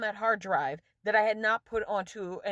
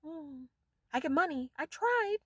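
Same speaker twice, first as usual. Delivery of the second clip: smug investor; 7 semitones higher than usual. Retold or imitated. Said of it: "If they die, then".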